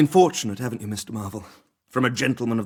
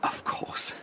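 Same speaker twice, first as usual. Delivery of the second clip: hushed reply; 9 dB quieter than usual. Acted. Doctor hears of her engagement.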